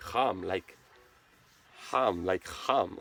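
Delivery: Cham repeated low and soft as /ham/, /am/; Swiss Cham. Shown as a demonstration. Showing viewers how locals say Cham, softly and low. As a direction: say Cham softly, a throaty h or none. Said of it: "So we would say".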